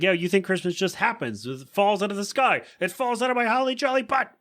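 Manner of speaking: half-hammed furious Lois rant; mock rant. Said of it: "screams at everybody".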